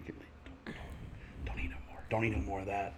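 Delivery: hushed, breathy whisper to his own belly; a pleading whisper to the food. Pretend. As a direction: whisper it like a secret to your stomach. Talking to his full stomach.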